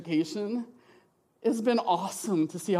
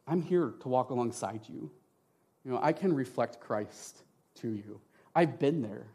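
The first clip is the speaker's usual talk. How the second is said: calm, kind promise to walk alongside; gentle reassurance; 6 semitones lower, 4 dB quieter than usual. Retold or imitated. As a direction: lower and softer, comforting. Reassuring parents of struggling kids.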